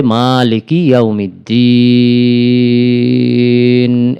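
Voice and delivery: মালিকি ইয়াউমিদ্দিন chanted slowly, the long vowel before দ্দিন stretched; drawn-out Quran recitation. Shown as a demonstration. Teacher recites the verse for students, slow tajweed chant. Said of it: "মাদ্দে আরজির সূত্র".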